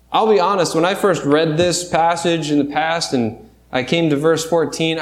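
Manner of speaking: ordinary talk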